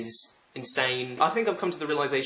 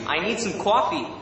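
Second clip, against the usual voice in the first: higher, put-on American accent, "coffee" as "cwaffee"; 6 semitones higher, 3 dB louder than usual. Shown as a demonstration. Brit trying American phrases for live chat, American accent. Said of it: "my best American accent".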